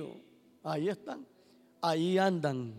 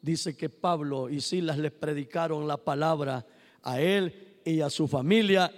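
ordinary delivery

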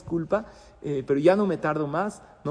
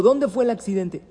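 sudden loud, higher alarm; alarmed question; 6 semitones higher, 8 dB louder than usual. Acted. A rabbi hearing of the accident, louder and higher in alarm. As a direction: ask it in a jolt of fear.

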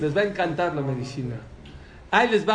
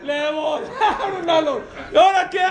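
laughing disbelief; 8 semitones higher, 5 dB louder than usual. Real, not acted. Telling a shocking story, laughing.

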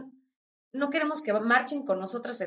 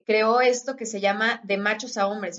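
ordinary talk

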